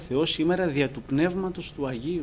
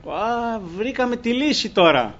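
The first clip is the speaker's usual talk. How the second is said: higher, louder, mock eureka; excited exclamation; 8 semitones higher, 5 dB louder than usual. Mimicking sailors who think they found the answer, excited. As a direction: imitate a sudden excited discovery.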